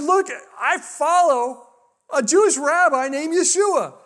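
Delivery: high, slow calling across a room; mock yell of protest; retold yelling. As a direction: call out each phrase high and stretched. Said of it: "So I'm yelling across the pharmacy".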